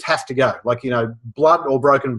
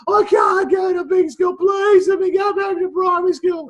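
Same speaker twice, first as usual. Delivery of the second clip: put-on wailing child. Little boy begging his parents not to go, high wailing. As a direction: beg your parents through tears. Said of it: "in floods of tears".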